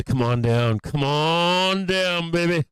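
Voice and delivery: loud, drawn-out huckster call; car salesman pitch. Pretend. Streamer hams a used car dealer, loud and stretched. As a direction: sell it like a cheesy TV car ad. Ad-man hype voice.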